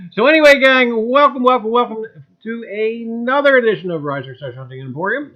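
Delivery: loud, high showman welcome; show host intro. Acted. Host opening the live stream to his gang, big showman welcome.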